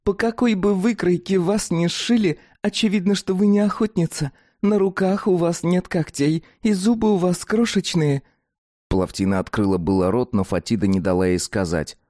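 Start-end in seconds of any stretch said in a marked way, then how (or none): 0.0-8.2 high, frank creature voice; dog-woman voice. Acted. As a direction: speak as a blunt dog-woman. Fatida explaining their nature.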